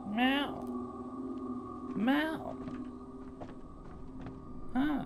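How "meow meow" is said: high rising meow back at the cat; mock meow. Owner meowing back at the cat, high mock meow. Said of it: "meowing at the cat".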